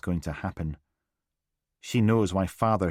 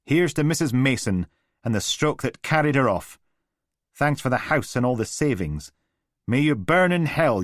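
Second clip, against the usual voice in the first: put-on bitter drunken toast; 6 semitones higher, 5 dB louder than usual. A narrator's reading. Drunk father's mocking toast.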